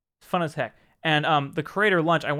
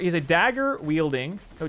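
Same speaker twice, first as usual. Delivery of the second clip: normal talking voice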